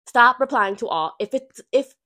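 loud, stern command; firm scolding. Real, not acted. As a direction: tell them off, hard. Scolding office email habits.

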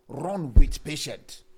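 slow, loud, booming declaration; emphatic shout. Acted. Preacher booming the key phrase to the church. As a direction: boom it out slowly to the congregation.

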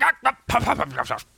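exaggerated spluttering, puffing cough; high start, trailing into puffs; acted cough. A narrator's reading. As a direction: splutter out a comic fake cough. Tigger demonstrates his explosive cough.

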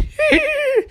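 squeaky, high-pitched laugh; gleeful squeal of laughter; high giggle. Real, not acted. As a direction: let out a thin high giggle. High giggle after voiced line.

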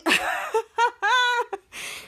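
high, drawn-out acted laugh; imitated group laughter. The whole Man Cave laughing at the crash, acted laughter. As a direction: laugh along as the characters. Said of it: "Man Cave were laughing".

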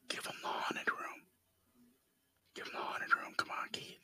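unvoiced whisper to avoid being overheard. Real, not acted. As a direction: whisper so the caller can't hear. Whispering during a phone call.

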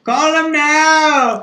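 high, slow hammed-up Jamaican accent; drawn-out hotline pitch, Jamaican accent. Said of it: "That's my Jamaican accent".

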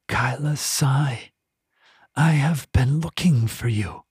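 put-on slow, smooth and eerie, the accent gone; a foreign will speaking through a mouth; smooth possessing voice. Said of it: "The voice was smooth".

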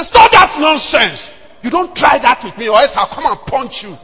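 shouting down a woman in church; angry shout. Retold or imitated. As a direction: bark at the woman to stop. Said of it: "I was shouting at her".

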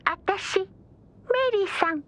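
too calm creepy doll. Acted. Creepy Mary-san phone call.

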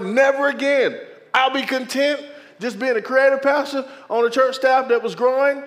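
high, loud insistence; insisting to God. Retold or imitated. Pastor telling God never again, loud and high. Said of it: "I had told the Lord".

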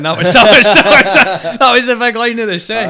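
a real laugh breaking into the line. Real, not acted. Musician recalling his best lyric, laughing loudly.

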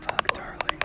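soft, voiceless whisper into the mic; hushed playful ASMR talk. Pretend. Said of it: "we should just whisper it".

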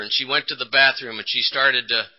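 ordinary delivery

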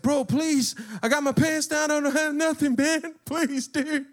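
high, loud mock pleading; freaking out. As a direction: imitate a desperate guy begging. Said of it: "He really started to freak the fuck out".

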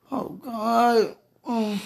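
choking up; tearful. Real, not acted. Praying alone, tearful plea to God. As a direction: voice breaks with tears. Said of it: "cry heard".

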